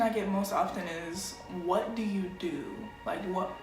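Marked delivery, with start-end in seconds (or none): none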